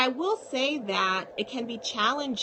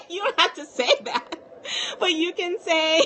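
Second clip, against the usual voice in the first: laughing; 8 semitones higher, 5 dB louder than usual. Real, not acted. Laughing at her own joke.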